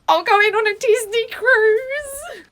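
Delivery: very high, loud, drawn-out squeal; elated shriek, stretched to the end; ecstatic announcement. Acted. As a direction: shout the big news slowly in delight. Announcing honeymoon destination with joy.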